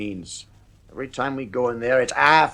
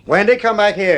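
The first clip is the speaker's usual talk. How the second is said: loud, higher call after her; 7 semitones higher, 5 dB louder than usual. Acted. Father calling his daughter back to him, loud.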